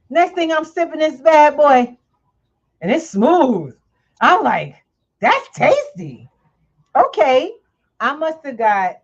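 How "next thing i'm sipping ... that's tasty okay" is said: much higher and louder gushing; delighted rave. Real, not acted. Telling the vendor how good his drink tasted, excited. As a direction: savor it like a happy surprise.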